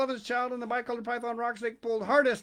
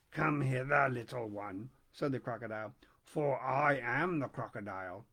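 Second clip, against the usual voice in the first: sly crocodile; 7 semitones lower than usual. A narrator's reading. Crocodile speaking.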